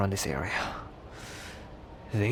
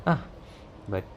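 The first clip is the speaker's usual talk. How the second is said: ordinary talk, nothing marked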